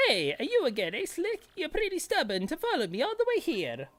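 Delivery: high, mocking game villain; sneering villain voice. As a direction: taunt him in a sly villain voice. Reads villain's lines in voice.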